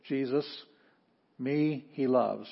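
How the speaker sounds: me, he loves: each word set apart slowly; teacher slowly glossing; slow word-by-word reading. Shown as a demonstration. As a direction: read the gloss like building blocks. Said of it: "word-by-word translation".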